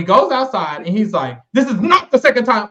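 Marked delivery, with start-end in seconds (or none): 1.5-2.7 imitating Justin. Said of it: "I don't know his voice".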